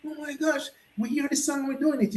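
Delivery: high, hushed thrilled rush; excited gushing. Retold or imitated. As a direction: bubble over with excitement. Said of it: "and I said".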